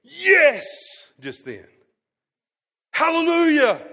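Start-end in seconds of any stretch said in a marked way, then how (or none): 0.0-0.6 much higher, loud cheering yes; mock shout. Imagined congregant cheering back at the preacher, loud mock yes. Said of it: "nobody stood up and went". 2.9-3.9 much higher, loud held hallelujah; mock hallelujah. Preacher shouting hallelujah in place of the silent church. Calls out for the congregation.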